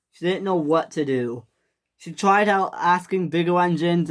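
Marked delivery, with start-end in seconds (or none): none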